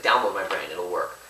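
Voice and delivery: Johnny begging his wife, low and quiet; dying Johnny. Acted. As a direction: murmur it low like a man fading out. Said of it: "So he's like".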